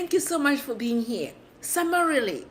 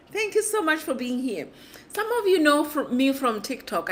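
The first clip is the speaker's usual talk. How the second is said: normal talking voice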